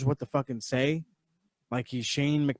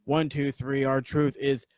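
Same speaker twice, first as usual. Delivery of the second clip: ordinary delivery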